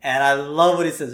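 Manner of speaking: usual speaking voice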